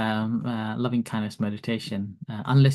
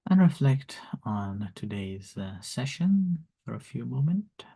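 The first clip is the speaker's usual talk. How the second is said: guided meditation; 3 semitones lower, 5 dB quieter than usual. Acted. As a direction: lead them gently into calm. Leads loving kindness meditation.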